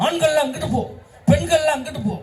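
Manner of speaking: high-pitched mock ordering of a crowd; crowd-marshal's order. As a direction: call out each group like an organiser splitting them up. Organiser ordering crowd to separate sides. (கூட்டத்தைப் பிரித்து அனுப்பும் உயர்ந்த குரல் கட்டளை)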